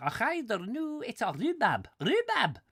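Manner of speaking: high, loud mock Scottish accent; hammed-up Scottish accent. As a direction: put on an excitable Scottish voice. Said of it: "have a Scottish accent".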